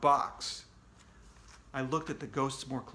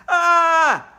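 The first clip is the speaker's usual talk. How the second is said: high, loud startled scream; a sudden terrified shriek; 14 semitones higher, 15 dB louder than usual. Acted. Kid waking to ghosts over his bed, screaming. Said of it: "I screamed".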